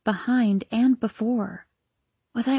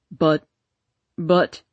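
halting, lost for words; stammering; 5 semitones lower than usual. Acted. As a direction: lower male voice, stammering. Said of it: "stammered my husband".

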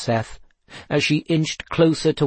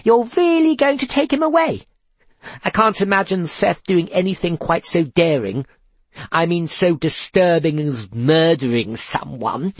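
higher, gleeful mock dismay; fake concern; 5 semitones higher than usual. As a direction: feign worry, relishing it. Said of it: "Seth could tell she was gleeful".

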